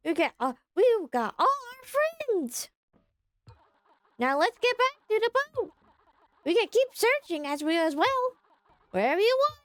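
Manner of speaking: high-pitched character voice. Acted. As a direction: pitch it way up and stretch the line endings. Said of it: "high-pitched voice for him".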